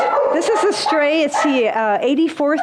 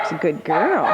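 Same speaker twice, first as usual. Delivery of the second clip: talking to a dog; 7 semitones lower than usual. Real, not acted. Praising the dog.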